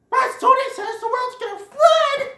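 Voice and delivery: high, loud panicky yell; rumor shout. Retold or imitated. Kids spreading news of the flood, shouting high. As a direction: shout the rumor like a frantic kid.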